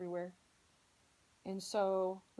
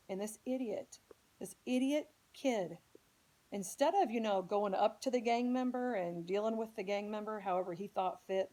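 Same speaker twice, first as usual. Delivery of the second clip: real infuriation; 5 dB louder than usual. Real, not acted. Speaker condemns the kid who shot at the bus, infuriated. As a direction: build from contempt into open anger. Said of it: "it infuriates me".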